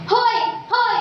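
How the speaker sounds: high, forceful hailing shout; calling a faraway friend. Shown as a demonstration. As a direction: call out to someone far off. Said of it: "Di ba ganon?". (pasigaw na tawag sa malayo)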